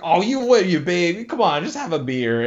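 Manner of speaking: lightly mocking peer pressure, starting high; pestering friend. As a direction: imitate a friend goading you into a drink. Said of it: "They pester you more".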